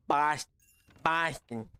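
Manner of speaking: halting, half-hearted try at a Boston accent; tentative Boston accent attempt. Shown as a demonstration. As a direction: have a poor go at Boston speech. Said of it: "I couldn't do a Boston accent".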